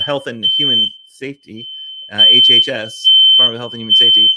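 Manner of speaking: talking loudly over a blaring alarm; shouting over fire alarm. Real, not acted. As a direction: raise your voice above the alarm. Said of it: "I'm sorry about the fire alarm that's going off".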